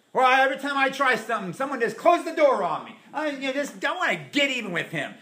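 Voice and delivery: high, fast whiny griping; mock bitter complainer. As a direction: play the sulking victim, a little hammed-up.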